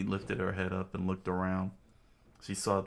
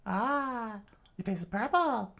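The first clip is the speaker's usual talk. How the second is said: high Southern falsetto, teasing; put-on high Southern woman; Southern accent; 14 semitones higher than usual. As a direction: tease him like a Southern lady. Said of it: "pull up my southern".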